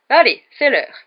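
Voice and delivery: loud, high call to the group; shouting. A narrator's reading. Teacher calling pupils to lessons, shouting. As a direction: call everyone in to class. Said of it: "cria Lockhart à l'adresse des élèves".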